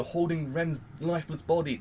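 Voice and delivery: normal talking voice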